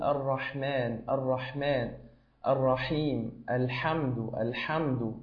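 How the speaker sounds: الرحمن with a strongly breathy ح; breathy ح demo. Shown as a demonstration. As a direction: push air through the ح in الحمد. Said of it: "حاول أن تزيد من صفة البحة أكثر".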